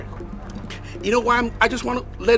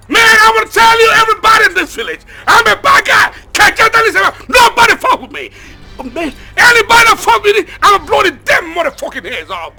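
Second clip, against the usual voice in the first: much louder and higher shouting throughout; raging rant; 7 semitones higher, 11 dB louder than usual. Acted. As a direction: boast menacingly to everyone. Madman rants to the village.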